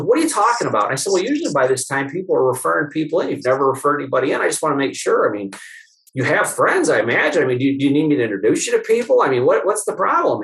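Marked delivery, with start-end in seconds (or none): 0.0-0.8 higher, bewildered question; a lightly voiced puzzled patient. Patient baffled by her chiropractor, mock puzzled. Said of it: "And she goes". 1.1-10.4 nervous rambling. Retold or imitated. Chiropractor rushing through his plea to a patient, fast nervous.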